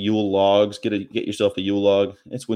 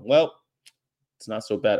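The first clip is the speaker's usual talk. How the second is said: ordinary delivery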